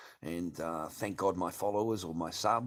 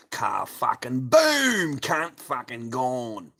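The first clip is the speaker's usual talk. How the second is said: loud, high, drawn-out BOOM; real triumphant banishing yell; booming shout; 4 semitones higher, 10 dB louder, much slower than usual. Real, not acted. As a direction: shout it slowly like a knockout blow. Banning a chat troll, gloating.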